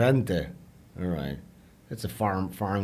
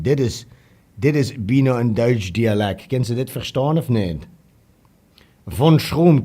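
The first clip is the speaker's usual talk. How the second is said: louder, emphatic Southern Dutch dialect; 4 dB louder than usual. Shown as a demonstration. Testing whether Von Troom understands his Southern Dutch dialect, emphatic. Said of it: "my Southern Dutch dialect".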